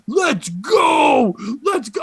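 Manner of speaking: high, drawn-out hype scream; yelling at the audience. Retold or imitated. Comic yelling at the audience, high hype scream. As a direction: scream it to rile up a crowd.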